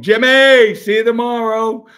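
high, loud teasing call from the car. Retold or imitated. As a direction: yell it across the street to wake the wife. Drunk yelling goodbye from car.

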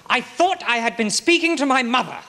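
high, loud and pleading, breaking free of the interruptions; desperate outburst. Acted. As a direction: finally shout it over the barrister, anguished. Accused cries out under cross-examination.

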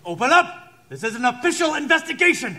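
calling through door. Acted. Inspector calling through the door to be let in. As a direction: demand entry with authority.

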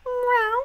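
high mock cat meow. Cat in scene.

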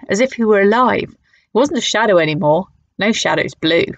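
usual speaking voice

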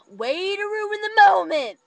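loud, high, slow exasperated call; mock scolding shout. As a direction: [name] telling her friend she spoiled it, loud mock scold. Scolding friend.

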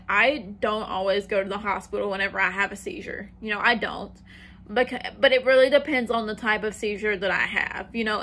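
ordinary talk, nothing marked

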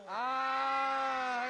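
loud drawn-out ah; shouted ah. Acted. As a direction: lead the crowd in a big ah. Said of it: "Everybody go".

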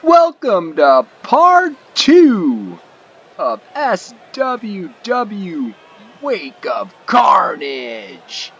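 slow, drawn-out hype shout of the show name; ring announcer intro. Acted. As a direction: stretch every word like a ring announcer opening the event. Show opening, announcing event name.

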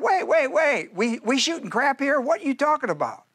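incredulous. Retold or imitated. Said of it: "I go like".

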